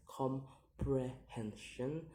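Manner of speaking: "comprehension" slowly split into syllables; slow, separated syllables on "comprehension". Shown as a demonstration. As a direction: sound out "comprehension" piece by piece. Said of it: "count the word syllable backwards".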